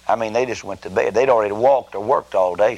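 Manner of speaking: usual speaking voice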